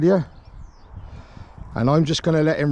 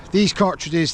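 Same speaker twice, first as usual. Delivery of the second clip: ordinary talk, nothing marked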